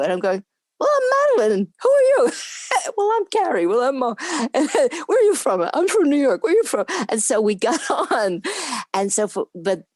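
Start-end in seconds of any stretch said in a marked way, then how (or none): 0.8-6.9 very high, loud, giggly back-and-forth; excited mock introductions. New castmates introducing themselves, high excited imitation. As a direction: act out giddy newcomers swapping names, pitched way up.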